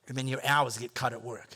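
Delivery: ordinary delivery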